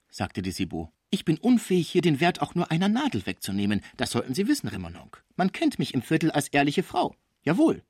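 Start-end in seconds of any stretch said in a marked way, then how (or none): 1.1-7.8 high-pitched, affronted protest; flaring indignation. Acted. Cibot protests to Remononc that she is honest, indignant. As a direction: defend your honour with rising heat. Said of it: "Ihre Augen flammten auf".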